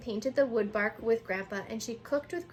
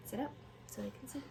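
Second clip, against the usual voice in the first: quiet aside; 13 dB quieter, faster than usual. Real, not acted. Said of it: "aside to child".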